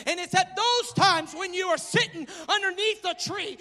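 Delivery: loud preacher's cry with a catch; fervent sermon build; impassioned preaching. Acted. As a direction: plead it out to the hurting. Preacher rising, near tears.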